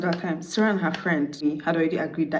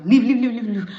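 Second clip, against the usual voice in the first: loud, higher-pitched rapid command; urgent ordering; 5 semitones higher, 7 dB louder than usual. Retold or imitated. Best man chasing the ex out, urgent ordering. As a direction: shoo him out loudly in mimicry.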